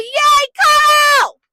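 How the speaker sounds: very high, loud squeal of joy; excited cheer. Retold or imitated. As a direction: cheer it out, stretching the name. Cheering the return of Carl.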